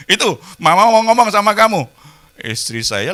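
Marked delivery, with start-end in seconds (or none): 0.1-1.8 wife to son. Retold or imitated. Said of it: "wife tells son, then leaves".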